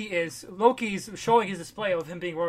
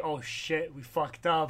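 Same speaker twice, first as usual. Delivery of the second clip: dismayed mutter; 3 semitones lower, 7 dB quieter, slower than usual. Retold or imitated. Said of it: "And Heimdall's like".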